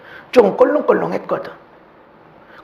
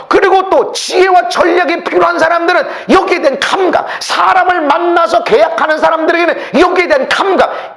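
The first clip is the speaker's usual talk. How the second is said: high, loud fervent sermon; preaching at full force; 8 semitones higher, 4 dB louder than usual. Acted. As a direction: preach it with fire.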